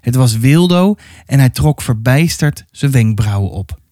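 ordinary delivery, nothing marked

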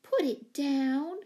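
high, loud, drawn-out plea; despairing cry. A narrator's reading. Owner begging monkey to drop the saucer, despairingly. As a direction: stretch each word out in despair. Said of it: "cried Miss Giddings despairingly".

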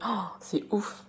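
soft gasped "oh", then hushed words; stunned reaction. Shown as a demonstration. Teacher reacting to a friend's story, hushed amazement. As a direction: breathe it out in demonstrated amazement. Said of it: "pour réagir à ce que quelqu'un".

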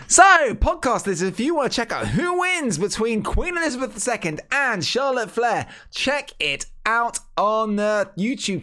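hyped-up podcast plug; big announcer read for the show. Acted.

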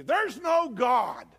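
loud, high, drawn-out shout; mock blatant devil. Devil flat out attacking a believer's faith, shouting. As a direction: proclaim it slowly at the top of your voice. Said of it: "He's probably not gonna say to you".

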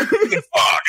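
1 mock grumble. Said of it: "He goes".

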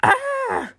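sudden high strangled cry of frustration; frustrated growl-cry. A narrator's reading. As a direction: let out a furious wordless yelp. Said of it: "made a noise ... almost a growl".

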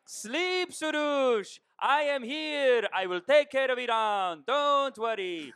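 put-on high, slow Persian accent; slow, solemn Persian accent vow. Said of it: "he just does this publicity stunt where he goes".

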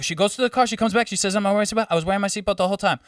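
high, animated imitated African accent. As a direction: African driver recounting the dispute, put on. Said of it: "I'm gonna do the accent".

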